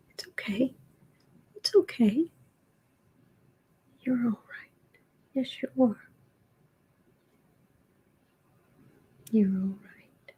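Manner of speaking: soft, whispered reassurance to a pet; calm the cat under your breath; gentle hushed comfort; soothing whisper. Real, not acted.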